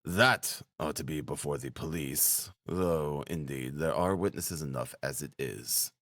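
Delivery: low, soft grumble to himself; muttering. A narrator's reading. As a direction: mutter it under your breath, voice dropped. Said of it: "Lucien muttered".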